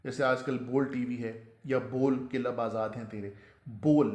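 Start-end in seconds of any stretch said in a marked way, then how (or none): 3.7-4.1 loud बोल with a long o; correct pronunciation. Shown as a demonstration. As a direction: punch out बोल, rhyming with goal. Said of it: "लफ्ज़ है बोल". (बोल, ज़ोर से लंबे "ओ" के साथ)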